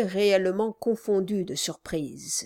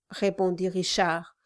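usual speaking voice

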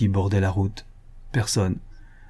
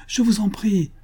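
je vous en prie pitched much higher, vous stressed; rising plea; 11 semitones higher than usual. Acted. Suitor pleads with the leaving woman, voice climbing high. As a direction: beg harder the second time.

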